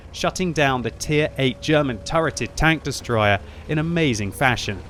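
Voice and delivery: ordinary talk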